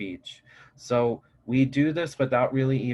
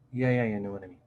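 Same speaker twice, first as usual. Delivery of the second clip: falling tune, low and flat; low inflection; 3 dB quieter, faster than usual. Shown as a demonstration. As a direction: let the pitch drop. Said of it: "when we speak with a lower inflection".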